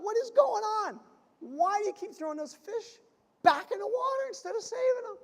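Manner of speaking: baffled, exasperated. Acted. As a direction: demand an answer in disbelief. Baffled friend confronting fisherman.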